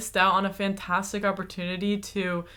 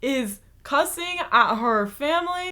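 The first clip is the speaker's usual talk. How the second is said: shocked gasp; 8 semitones higher than usual. Real, not acted. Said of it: "reacting to cussing clip".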